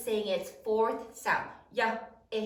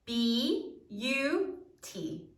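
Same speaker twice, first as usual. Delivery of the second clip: slow syllables; much slower than usual. Shown as a demonstration. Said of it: "we're gonna exaggerate it".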